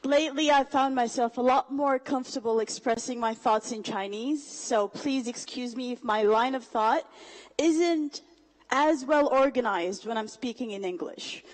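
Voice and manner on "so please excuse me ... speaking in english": ordinary talk, nothing marked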